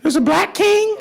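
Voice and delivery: put-on high, wide-eyed kid question; surprised child. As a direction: ask it like a puzzled child.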